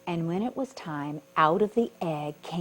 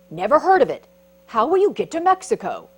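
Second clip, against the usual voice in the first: ant's voice; 5 semitones higher, 11 dB louder, faster than usual. Acted. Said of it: "said the ant".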